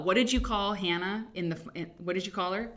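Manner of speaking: ordinary talk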